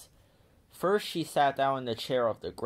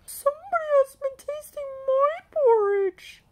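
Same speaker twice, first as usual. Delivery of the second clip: very high, squeaky mother bear voice; shrill up in the head as the middle bear; middle-sized bear voice; 7 dB louder than usual. A narrator's reading. Middle bear finds her porridge tasted, very high voice. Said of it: "said the middle-sized bear in her middle-sized voice".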